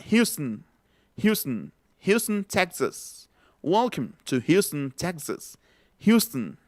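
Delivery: Houston as "HYOO-stn", last vowel swallowed, also in Texas phrase; reduced English Houston. Shown as a demonstration. Teacher showing how Houston would sound, schwa pronunciation demo. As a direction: drop the O of Houston, stressing the first syllable. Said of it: "cómo sonaría la palabra".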